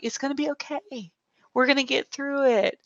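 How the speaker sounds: soft, reassuring comfort; calm her down gently. Retold or imitated. Telling the nervous guest it will be fine, soft and soothing.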